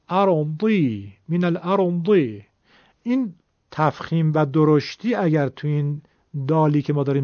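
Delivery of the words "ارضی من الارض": slow ارضی with thick emphatic d; heavy dal zad. Shown as a demonstration. Teacher showing zad with kasra slowly. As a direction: draw out ارضی, keep the d heavy.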